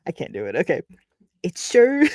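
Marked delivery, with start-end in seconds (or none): 1.4-2.0 high, louder stab at British; abandoned attempt at a British accent. Reader trying the farmhouse man before giving up, British accent. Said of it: "This man has a British accent".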